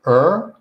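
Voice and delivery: "er" with lips pushed out; er sound demo. Shown as a demonstration. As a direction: round and protrude the lips for "er". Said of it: "protrude your lips outwards".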